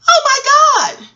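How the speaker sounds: high, slow, loud gasp of disbelief; stunned. Retold or imitated. Mother watching her son skate away, stunned and high. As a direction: let the shock spill out, drawn and high.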